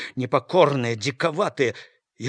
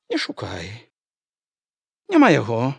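mocking; 3 semitones lower, 5 dB louder than usual. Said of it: "кпліва прамовіла яна".

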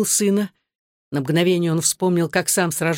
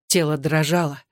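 plain reading voice

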